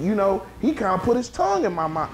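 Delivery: ordinary talk, nothing marked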